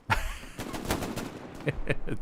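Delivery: breathy, voiceless fit of giggles; helpless giggle. Real, not acted. Commentator laughing at his own failed joke, giggling. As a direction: dissolve into laughter at yourself.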